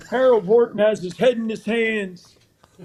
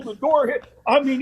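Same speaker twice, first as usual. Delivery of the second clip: ordinary talk, nothing marked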